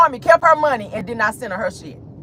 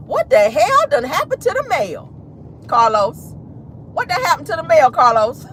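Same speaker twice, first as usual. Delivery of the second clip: Miss Millie; 5 semitones higher than usual. Acted. Host questioning Carlos about the mail, in Miss Millie voice. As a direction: pitch it up in mock indignant questioning. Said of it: "in my Miss Millie voice".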